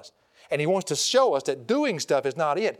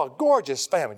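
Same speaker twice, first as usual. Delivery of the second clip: ordinary talk, nothing marked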